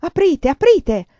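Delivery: loud, slow shouting at the door; urgent shout. A narrator's reading. Prilukov at the locked door shouting to be let in. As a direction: bellow to be let in, slowly. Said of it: "che urlava".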